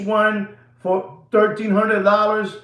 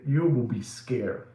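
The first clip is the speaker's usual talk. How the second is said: hushed ominous; 9 semitones lower, 9 dB quieter than usual. Real, not acted. Ominous warning.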